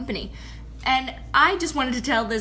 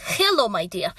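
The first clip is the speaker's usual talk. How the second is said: imitated Russian-accented greeting; thick Russian accent; 3 semitones lower than usual. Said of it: "a thick Russian accent".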